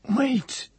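sudden high stammered cry. A narrator's reading. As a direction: blurt it out, stammering. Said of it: "he stammered".

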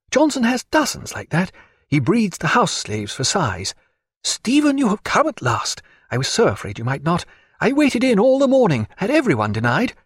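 high-pitched, effusive lady's voice; eager Diana. Acted. Diana welcoming Stephen after waiting all morning, high and eager. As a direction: gush at him, pitch leaping up.

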